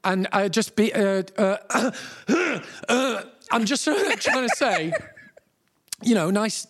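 re-enacted panicky backpedalling with broken starts; flustered panic. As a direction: fumble for words as the awkwardness mounts. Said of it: "I'm starting to panic now".

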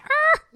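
sharp high cringing "argh"; high, loud cringe yelp; frustrated groan. Retold or imitated. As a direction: let out a loud squeal of cringe. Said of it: "I'm like".

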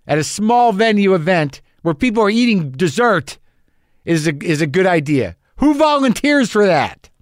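high-pitched, mounting comic indignation; exasperated rant. Acted. Comedian berating himself for volunteering between rock acts, exasperated.

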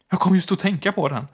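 hysterical. A narrator's reading. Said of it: "sa hon hysteriskt".

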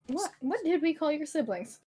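high, sing-song talk to a cat; pet talk. Real, not acted. Asking the cat about its littermates, cooing pet talk. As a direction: coo the question to your pet.

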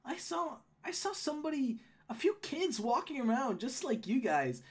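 high-voiced gnome woman recalling a scare; Holly, concerned. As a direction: check on them anxiously in the gnome voice.

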